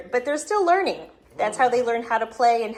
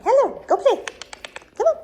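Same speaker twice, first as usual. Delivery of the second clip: high, louder coaxing; puppy talk; 13 semitones higher, 5 dB louder than usual. Real, not acted. Owner telling puppies to go play, high puppy talk. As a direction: shoo them off playfully, up high.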